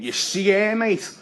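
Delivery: hammed-up meathead boasting about a girl; nightclub lad. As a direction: play the cocky bloke at the bar.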